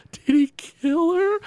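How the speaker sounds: put-on high, slow, wide-eyed kid question; imitating a little girl. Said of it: "And Lisa's like".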